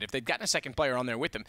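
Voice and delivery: ordinary talk, nothing marked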